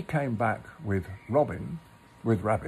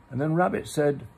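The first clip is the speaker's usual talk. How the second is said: plain reading voice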